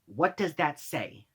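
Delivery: slow, soft condescension; talking down. Retold or imitated. Manager quizzing a worker like a two-year-old, talking down. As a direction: speak slowly, as if to a toddler. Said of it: "he just starts talking down to me".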